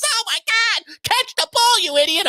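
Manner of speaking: high, mock exasperated yell; retold yell. Yelling at his quarterback on TV. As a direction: imitate yelling at the TV. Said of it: "I'm screaming and yelling".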